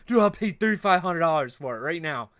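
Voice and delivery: loud, eager mock buyer begging; desperate buyer. Streamer mimics desperate card buyer, loud and eager. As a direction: beg like a desperate buyer waving cash.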